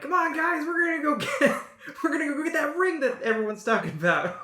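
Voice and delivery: high, loud, excited rallying call; rally the party with giddy enthusiasm; eager young adventurers. Acted. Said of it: "you overhear them like".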